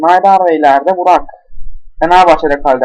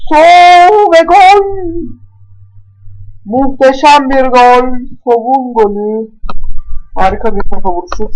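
high, loud, stretched goal scream; screaming football commentator; 8 semitones higher, 4 dB louder than usual. Acted. Goal call in football game.

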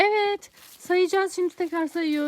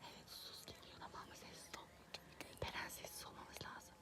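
whispering while hiding; 10 semitones lower, 30 dB quieter than usual. Real, not acted.

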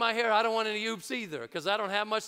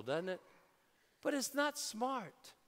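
ordinary delivery

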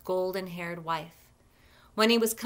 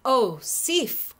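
raised, louder and higher call; crying out; 5 semitones higher, 5 dB louder than usual. A narrator's reading. Thor finds his veiled wife, crying out in dismay. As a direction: cry out in mild dismay. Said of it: "cried Thor".

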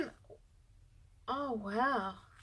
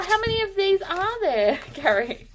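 normal talking voice

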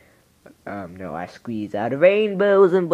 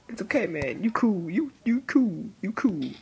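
soft reassurance; 7 semitones higher, 5 dB quieter than usual. Retold or imitated. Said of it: "You can say".

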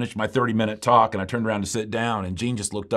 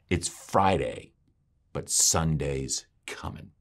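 low, slow, measured preacher's line; slow start; 8 semitones lower, 5 dB quieter, slower than usual. Retold or imitated. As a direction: imitate the old preacher starting slow, spacing the words. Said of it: "He started off slow".

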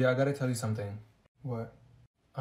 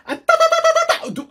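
very high, loud stuttered nonsense; invented language; 6 dB louder, faster than usual. Acted. Friend telling a story in secret language, squeaky gibberish. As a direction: rattle off squeaky made-up syllables. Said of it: "speaking our language".

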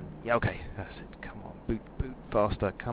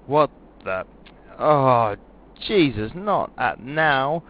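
loud, high shocked cry; alarmed outburst; 5 semitones higher, 11 dB louder, slower than usual. Acted. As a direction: yelp as it all fails. Computer fails again mid-landing.

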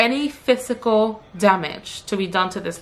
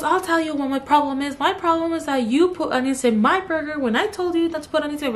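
mimicking customer; 6 semitones higher than usual. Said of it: "She goes".

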